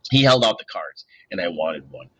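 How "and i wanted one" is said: ordinary delivery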